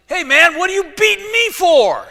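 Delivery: high, loud cartoon donkey voice; talking donkey. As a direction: squeal it out like an indignant donkey. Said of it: "He doesn't actually say it that way, but it sounds good".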